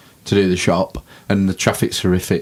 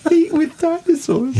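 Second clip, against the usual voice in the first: laughing through the words. Real, not acted. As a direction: crack up while saying it. Laughing at the joke.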